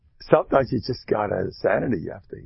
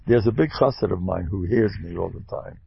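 ordinary talk

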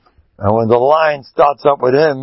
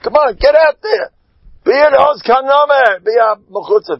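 egging on; 11 semitones higher than usual. Retold or imitated. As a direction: push them out the door with your voice. Boys urging each other to speak.

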